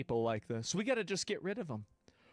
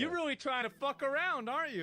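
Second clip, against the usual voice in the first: taunting; 10 semitones higher than usual. Real, not acted. Taunting the woman in the clip.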